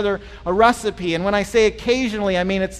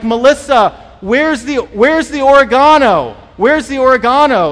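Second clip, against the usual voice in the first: loud call to another room, higher pitch; shouting across the house; 4 semitones higher, 9 dB louder than usual. Retold or imitated. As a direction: yell for Melissa from the pantry. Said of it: "I shout to Melissa".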